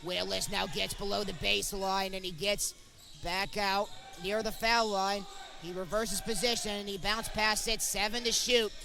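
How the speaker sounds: normal talking voice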